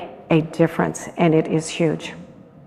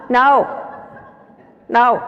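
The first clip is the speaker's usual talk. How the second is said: firm no; 4 semitones higher than usual. Shown as a demonstration. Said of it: "I wanna hear the new word".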